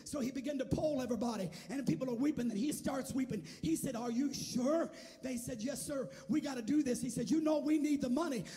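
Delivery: loud, driving pulpit storytelling; impassioned revival preaching. Real, not acted. Preacher telling how a weeping church chose to give, impassioned revival preaching. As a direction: build to a high, ringing shout at the end.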